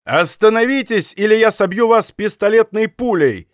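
high-pitched shouted warning; shouted threat. A narrator's reading. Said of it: "крикнул он вторично".

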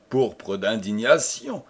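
plain reading voice

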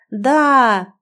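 put-on kids shouting. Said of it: "дружно орут они".

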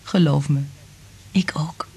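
hushed whisper. Acted. Liz answers Frank's love in a whisper. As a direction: say it barely aloud. Lovers' intimate exchange.

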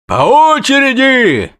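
high, loud bellow at the crowd; bandaged rider yelling at a crowd pushing forward. Acted. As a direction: yell orders over the din. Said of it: "орал перевязанный".

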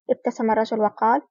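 plain reading voice

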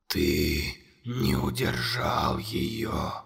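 very low, slow, whispered monster voice. A narrator's reading. Monster whispering accusations to Conor, low and slow. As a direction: loom over the boy and breathe every word.

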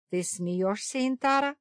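stern question, rising high. A narrator's reading. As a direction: ask it sternly. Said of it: "строгий голос Пороха".

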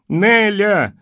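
full-voiced high call across the yard; shout with all her might. A narrator's reading. Woman in towel turban shouting for Nelly. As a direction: yell the name as loud as she can. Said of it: "изо всех сил закричала".

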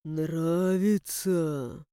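low, slow, silky question; insinuating voice. A narrator's reading. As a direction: purr it slyly and slowly. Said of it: "Вкрадчивый голос".

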